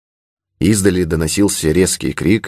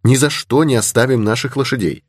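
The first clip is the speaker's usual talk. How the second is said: higher-pitched, mild refusal; objecting; 7 semitones higher than usual. A narrator's reading. Creole girl refusing to abandon the horses, objecting. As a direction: object in a young lady's raised pitch. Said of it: "возразила креолка".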